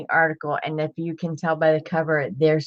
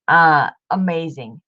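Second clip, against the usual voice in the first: ordinary talk, nothing marked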